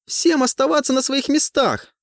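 high piercing yell; shrill shout. A narrator's reading. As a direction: yell at the top of her lungs. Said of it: "Во всё горло кричит".

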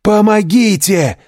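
high, loud cries for help; desperate shouting. A narrator's reading. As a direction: scream for help in panic. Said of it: "воскликнул тот".

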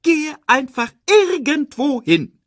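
very high, loud exasperation; exasperated order. A narrator's reading. Timon sends Pumba anywhere, high and exasperated. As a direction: send him off, fed up and high-pitched.

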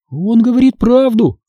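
usual speaking voice

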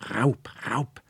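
high, rasping parrot cry going breathless; squawking parrot. A narrator's reading. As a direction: screech it like a frightened parrot. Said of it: "der Papagei schnarrte". (schnarrender Papagei, hoch und kratzig)

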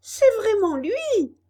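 très aigu, fort et lent; s'exclame ravie; exclamation. A narrator's reading. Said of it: "s'exclama Colombine". (Colombine passe la tête derrière le rideau et s'exclame, très aiguë)

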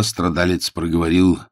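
plain reading voice

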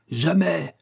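high-pitched, drawn refusal; defiant cry. A narrator's reading. Inventor facing revolvers, high defiant refusal. Cornered inventor defies armed men.

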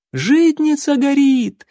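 high, slow, loud alarmed cry; shouting woman. Acted. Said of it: "закричала какая-то женщина". (женщина в панике кричит о пожаре)

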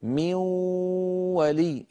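"من ولي" with the noon turned into a long nasal waw. Shown as a demonstration. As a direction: glide from "من" straight into a humming waw of "ولي". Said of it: "انتقلنا من الميم إلى الوا".

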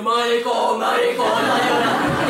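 slow, drawn-out playground jeer; sing-song schoolyard mockery; taunting children. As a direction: taunt like a gang of kids. Children acted, taunting crying boy.

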